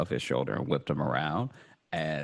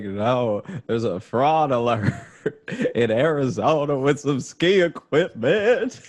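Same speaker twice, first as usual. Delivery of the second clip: laughing explanation; 8 semitones higher, 6 dB louder than usual. Pretend. Imagined reply to the date.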